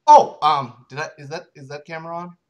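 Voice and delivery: ordinary talk, nothing marked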